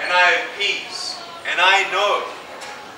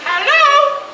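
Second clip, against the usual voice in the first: high, loud, sing-out hello; acted phone greeting; 7 dB louder than usual. School answering the speaker's first phone call, very high mock greeting. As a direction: shoot the pitch way up on a mock greeting.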